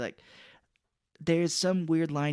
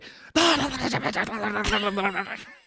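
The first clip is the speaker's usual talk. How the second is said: high, fast nonsense syllables; mock Pesci gibberish; laughing gibberish babble; 7 semitones higher than usual. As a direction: babble like a cartoon crook. Gibberish Pesci-style noises.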